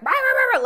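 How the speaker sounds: high, loud mock dog barks; imitated barking. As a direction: bark it out like the dogs. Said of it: "all the dogs were barking".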